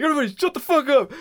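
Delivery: high-pitched, rushed mock outburst; retold yelling. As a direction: imitate a man snapping at kids. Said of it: "he starts yelling at and getting into it with kids".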